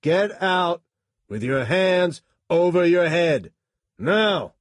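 loud high commands; loud, forceful shouting, hitting "Now!". A narrator's reading. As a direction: keep yelling orders at the driver. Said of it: "he shouted at the driver".

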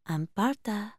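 soft, hushed calling of a name; quiet call. A narrator's reading. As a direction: call the name softly from the dark. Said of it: "the voice said again".

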